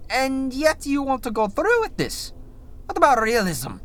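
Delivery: incredulous questioner. Acted. Reviewer imagines writers' meeting, exasperated protest. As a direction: object in a high put-on voice. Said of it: "I imagine the conversation was something like".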